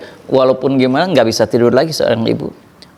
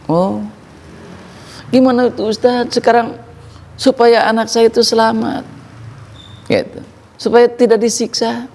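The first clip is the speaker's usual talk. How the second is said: anxious mother; 7 semitones higher than usual. Retold or imitated. Worried mother pleads with ustadz.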